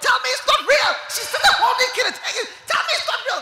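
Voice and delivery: very high, loud shouting plea; terrified plea. Retold or imitated. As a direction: beg in terror at death's door. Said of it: "She immediately held him and said".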